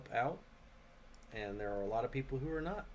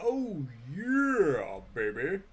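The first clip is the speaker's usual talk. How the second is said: excited cheer; 4 semitones higher, 10 dB louder, much slower than usual. Real, not acted. Gamer cheering loudly over a crafted item, excited whoop. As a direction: whoop with delight at the new item.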